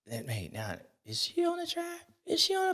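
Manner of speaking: high, hushed alarmed question; mock alarmed is she on the track. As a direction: retell the moment of noticing her on the track. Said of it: "I was like".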